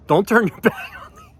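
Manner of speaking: high mock yell breaking into laughter; retold screaming. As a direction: imitate yelling after him, laugh midway. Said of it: "So I'm still screaming".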